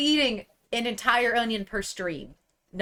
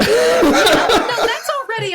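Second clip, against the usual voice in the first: laughing; 11 dB louder, much slower than usual. Real, not acted. GM bursts out laughing.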